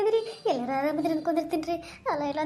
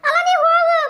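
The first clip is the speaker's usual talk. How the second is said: high, pleading village woman voice, close to tears; frightened woman; 6 semitones higher, 7 dB louder than usual. Acted. A scared woman begging the ghost to leave her, high tearful plea. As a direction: beg the ghost to go away, voice pitched up.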